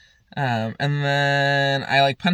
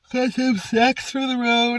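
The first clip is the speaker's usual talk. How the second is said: higher, slow, playful put-on voice; sing-song put-on voice; 10 semitones higher, slower than usual. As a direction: stretch it out in a silly voice, slowly. Repeats line in a put-on voice.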